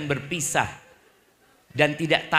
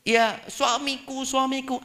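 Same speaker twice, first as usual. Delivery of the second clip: high, slow, drawn-out endearment; doting wife; 10 semitones higher, slower than usual. Acted. Wife who only says my husband, high slow mock devotion. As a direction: imitate a passive wife cooing slowly up high.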